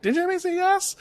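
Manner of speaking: put-on high, squeaky childish voices; mock toddler squabble; little kid voices. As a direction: replay the toddlers' argument in their voices. Replaying the kids' knife-hand argument.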